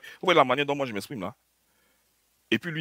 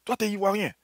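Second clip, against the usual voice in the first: imitating a friend; 9 semitones higher than usual. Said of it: "il dit".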